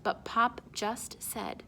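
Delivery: ordinary delivery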